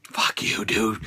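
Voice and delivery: muttered insult; whispered curse. Retold or imitated. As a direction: hiss it under your breath. Mocking Reigns' smug face.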